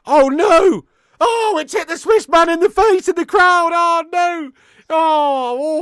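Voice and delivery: loud high shocked oh no; real shock, loud and high; shocked cry. Real, not acted. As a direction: gasp it out in horror at the hit. Ball hits old man in crowd.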